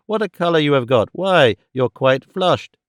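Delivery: plain reading voice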